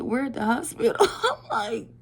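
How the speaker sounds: high, loud, laughing retelling; helpless laughter breaking in; cracking up. Retold or imitated. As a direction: squeal it through a laugh. Repeats reveal, cracking up.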